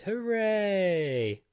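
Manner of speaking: a high, excited little hooray; cheer. Acted. Cheering to viewers as the watch pairs, excited. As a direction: celebrate the pairing like a small victory. Watch and phone finally paired.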